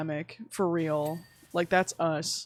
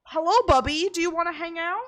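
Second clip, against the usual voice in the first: higher, gentle pet-talk; cooing at cat; 9 semitones higher than usual. Real, not acted. Greeting her cat who just walked up, cooing.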